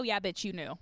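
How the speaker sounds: ordinary delivery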